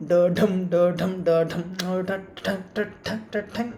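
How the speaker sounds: ഡ ഢം in even pairs, the ഢം weightier, then ട ട ട tapped out; rhythmic syllable beat. Shown as a demonstration. Teacher sounding out the poem's meter for students, rhythmic ഡ ഢം beat. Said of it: "ഇതൊരു rhythm ഉണ്ടാകും".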